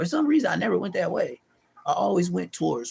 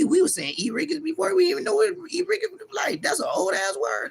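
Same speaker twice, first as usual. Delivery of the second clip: ordinary talk, nothing marked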